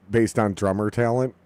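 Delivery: ordinary talk, nothing marked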